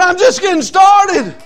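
high, loud and slow protest; eager shout. Retold or imitated. Song leader protesting to the preacher, eager shout. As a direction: insist with boundless zeal. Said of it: "He said".

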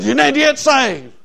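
imitating a woman parroting the preacher; copycat echo. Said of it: "everything I'd say, she'd copy me".

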